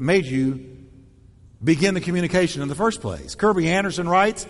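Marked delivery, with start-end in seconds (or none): none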